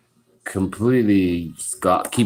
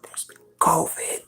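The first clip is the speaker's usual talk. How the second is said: high unvoiced child's whisper; 8 semitones higher, 6 dB quieter than usual. Son tells dad outside, whispering. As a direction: confide it in a hushed breath. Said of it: "he goes".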